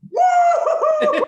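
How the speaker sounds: high, loud whoop; whooping cheer. Retold or imitated. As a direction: let out a big whoop. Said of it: "Gaston's just all".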